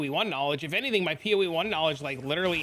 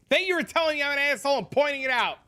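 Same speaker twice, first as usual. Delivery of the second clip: sheepish high apology; 9 semitones higher, 3 dB louder than usual. Real, not acted. Sheepish thanks to chat.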